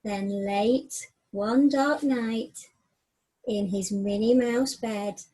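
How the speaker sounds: slow, hushed bedtime-story reading; story narration. A narrator's reading. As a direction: draw the children into the night scene.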